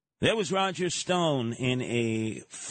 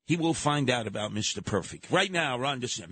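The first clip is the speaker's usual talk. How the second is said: ordinary delivery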